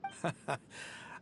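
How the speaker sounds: quiet breathy chuckle; soft laugh. Acted. Mercenary chuckling at the scholar's plan. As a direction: chuckle as it dawns on you. Roman reacts to Sataria's news.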